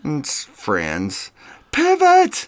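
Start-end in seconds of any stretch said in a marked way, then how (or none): 1.7-2.5 high-pitched loud yell; Ross. Acted. Ross yelling while carrying a couch upstairs, high and loud. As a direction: yell the order up the stairwell. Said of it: "Ross keeps yelling".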